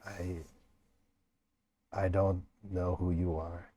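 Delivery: quiet rasping whisper, barely voiced; hoarse whisper. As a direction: push the words out through a raw throat. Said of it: "You can only speak in, like, a hoarse whisper".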